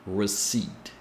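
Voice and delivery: "receipt" said "re-seet", no P; soft re-seet; silent P. Shown as a demonstration. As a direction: say "receipt" without the P. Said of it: "spelled like this".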